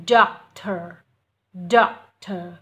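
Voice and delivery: "Doctor" loud, opening on a firm d, not a z; hard D sound. Shown as a demonstration. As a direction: hit the d in "Doctor" cleanly. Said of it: "Không đọc thành giờ nhá". ("Doctor" đọc rõ âm d, không thành "giờ")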